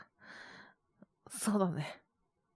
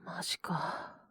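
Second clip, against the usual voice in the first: whispered, talking to himself; muttered to himself. Acted. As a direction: grumble under the breath. Alone after call, muttering.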